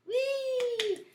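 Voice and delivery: high, drawn-out praise; delighted cheer. Real, not acted. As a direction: hold it on a high, gleeful note. Teacher cheers the class's repetition.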